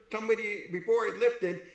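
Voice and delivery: ordinary delivery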